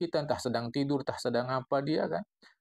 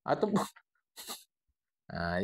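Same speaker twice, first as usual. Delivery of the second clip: usual speaking voice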